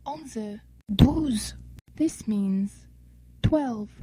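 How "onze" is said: normal talking voice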